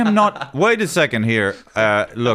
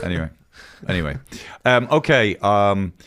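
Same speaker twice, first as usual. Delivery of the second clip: ordinary talk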